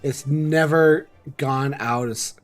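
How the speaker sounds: ordinary talk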